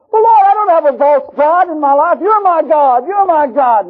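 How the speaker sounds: high, loud pleading insistence; desperate protest. Retold or imitated. Preacher insisting to God he has no idol, high plea. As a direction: insist to the Lord, high and pressing.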